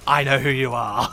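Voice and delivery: loud, playful accusing call; mock accusation. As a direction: call them out loudly, in jest.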